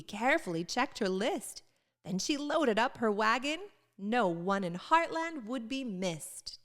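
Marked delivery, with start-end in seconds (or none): none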